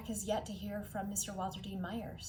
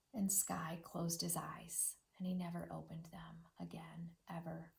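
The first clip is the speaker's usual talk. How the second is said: a sad near-whisper, fading away to nothing; hushed sorrow; 6 dB quieter than usual. Acted. Teacher telling kids the dog died, in a sad hushed whisper. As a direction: breathe it out gently like grieving news.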